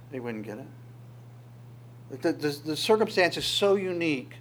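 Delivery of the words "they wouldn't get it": slow, much softer echo, nearly fading out; soft slow repeat. Shown as a demonstration. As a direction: let the repeat sink into near silence.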